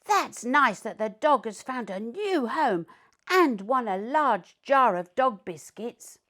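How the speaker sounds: be glad for the new dog; happy Alfie. A narrator's reading. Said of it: "barked Alfie".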